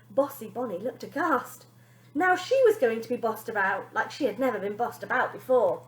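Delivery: plain reading voice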